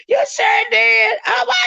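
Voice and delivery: high, slow shouted agreement; excited shout. Real, not acted. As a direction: holler it out high and drawn. Hyped agreement with chat.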